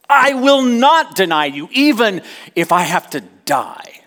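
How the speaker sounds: high, loud, stress on not; passionate vow. Retold or imitated. Peter insisting to Jesus, loud and passionate. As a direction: swear it with full conviction. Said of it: "he spoke emphatically, passionately".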